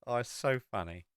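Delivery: normal talking voice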